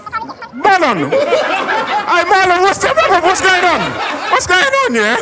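high, loud indignant yell; shouting into phone. Retold or imitated. Shouting at the flirting driver from a hotel lobby, indignant. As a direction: holler at him through the phone. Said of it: "I'm shouting on my phone".

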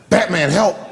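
mock distress call; cry for help. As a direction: call out comically for rescue. Retold cry for help to acquaintance.

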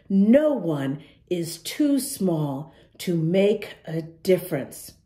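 ordinary delivery

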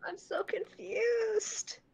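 drawn-out confused whine. Real, not acted. Tech meltdown, drawn-out whine.

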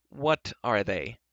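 ordinary delivery

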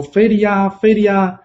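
a high yelled alarm; crying for help. Acted. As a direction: shriek it out to draw a crowd. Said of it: "然后就大叫说".

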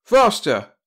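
high, loud barked command; loud order. A narrator's reading. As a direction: bark the order. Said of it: "he barked".

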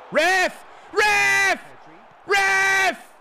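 high, loud repeated appeal; outraged shout. Real, not acted. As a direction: yell for the referee's attention. Appealing to referee in game.

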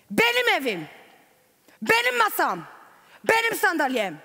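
imitating an enraged husband shouting; angry shout. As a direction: bark each "my" like a tyrant claiming things. Husband's possessive outburst, retold.

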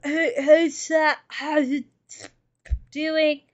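mock toothless slurred greeting; fala desdentada de mentira. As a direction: mangle the words as if fresh from having teeth pulled. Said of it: "hear me in here talk like".

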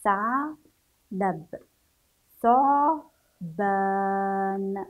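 "ثعلب، ثعبان" spelled out very slowly, every letter sounded; slow syllable-by-syllable reading. Shown as a demonstration. Teacher reads each letter aloud for pupils, very slow. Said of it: "ننطق كل حرف".

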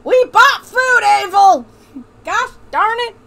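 high, exasperated Southern mother; hammed-up Southern accent, building to a loud yell at the child; put-on Southern accent. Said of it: "This accent is really killing me".

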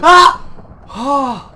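high loud gasp and shriek; real shocked scream; startled scream. Real, not acted. Sudden scare.

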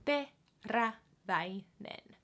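Teräväinen very slow, each vowel clear; slow syllables. Shown as a demonstration. Teacher slowing tricky name down. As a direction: stretch Teräväinen out. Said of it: "might be a little bit tricky".

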